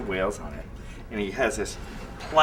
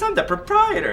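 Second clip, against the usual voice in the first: much higher mock-pompous boss voice, louder; imitating the boss; 6 dB louder than usual. [name] imitating the owner announcing himself, high and mock-pompous. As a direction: squeak it out like a self-important owner.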